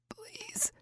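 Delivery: a dry, breathy high croak of Please; barely any voice left, just a croak; injured woman croaking please to her man. Acted. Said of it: "I croaked out".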